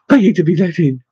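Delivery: plain reading voice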